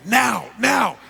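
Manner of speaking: loud, high call to go now; urgent shout. Retold or imitated. Father signals his table to jump up, shouting. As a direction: snap the group into action with a shout.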